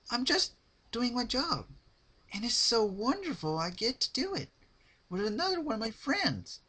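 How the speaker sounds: high, loud, sugary cheerful pony voice; Pinkie Pie voice. Acted. Narrator voicing Pinkie to terrified Rarity, high cheerful. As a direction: chirp it brightly, creepily sweet.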